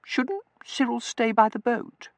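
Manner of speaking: high, slow, coy question; delicate, high-pitched reluctance; prettily hesitant. A narrator's reading. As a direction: ask coyly, hesitating. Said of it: "Tossie hesitated prettily".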